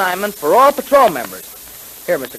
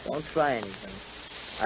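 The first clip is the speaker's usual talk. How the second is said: low, soft and slow warning; quiet threat; 8 semitones lower, 15 dB quieter, slower than usual. Acted. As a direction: menace him under your breath. Holding captive at gunpoint.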